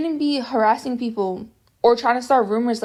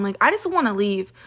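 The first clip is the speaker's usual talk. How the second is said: usual speaking voice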